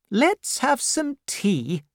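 put-on high, slow, imperious old lady voice; a severe aunt demanding at the door; stern old aunt. Aunt Betsy questions the frightened widow, high and slow.

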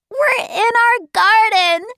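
put-on high, gleeful child's voice; laughing delight. A narrator's reading. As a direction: exclaim it high, on the edge of laughter. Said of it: "looked around and laughed".

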